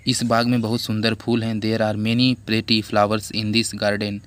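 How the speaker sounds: teacher dictating to the class, loud and slow; classroom dictation. Shown as a demonstration. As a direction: read each sentence out so the class can write it. Loud dictation of sentence list.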